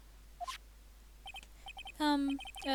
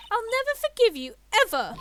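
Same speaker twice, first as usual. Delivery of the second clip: angry outburst; 10 semitones higher, 10 dB louder than usual. Acted. Pearl angry at Nick.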